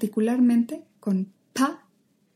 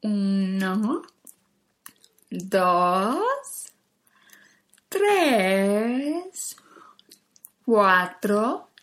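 each number chewed slowly like a cow eating grass; much slower than usual. Shown as a demonstration. Teacher showing the class how to chew each number. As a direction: chew every word with exaggerated mouth. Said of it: "vamos a masticar cada uno de los números".

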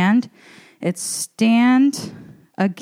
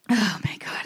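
out of breath; 8 dB quieter than usual. Real, not acted. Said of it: "I'm getting so out of breath".

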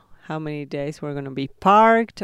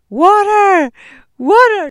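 high, loud alarm cry of "Water!"; panicked shout; 13 dB louder, slower than usual. Retold or imitated. Co-driver retells yelling for water at the fire, panicked shout. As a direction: yell it out as an urgent alarm.

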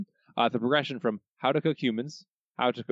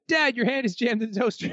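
excited Lisa cry; 14 semitones higher, 4 dB louder than usual. Retold or imitated. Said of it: "from Matt".

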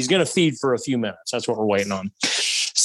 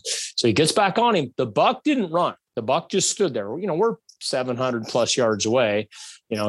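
ordinary delivery

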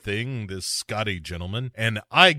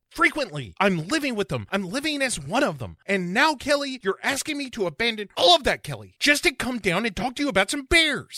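high squeaky put-on aggrieved naturalist; silly high complaining voice; Scotty voice; 11 semitones higher than usual. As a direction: whine it high, put upon. Voicing Scotty in bit.

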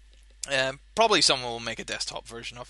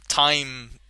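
usual speaking voice

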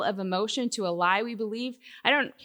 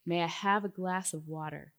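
put-on a soft, calm little boy asking Mama; softly and politely, like a small child; very quiet, calm child's request; 3 semitones lower, 7 dB quieter than usual. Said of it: "he very calmly said".

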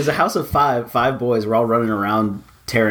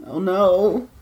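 mock dread; 11 semitones higher, much slower than usual. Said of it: "I'm just like".

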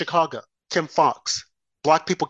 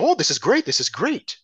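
lightly mocking gleeful commentators; a touch of fake cheer; mock celebration; 5 semitones higher than usual. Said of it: "celebrating and saying".

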